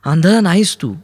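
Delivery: high-pitched, forceful protest; emphatic outburst. A narrator's reading. Kaumudi yells at Aruni to stop him, emphatic outburst. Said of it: "Kaumudi ओरडली".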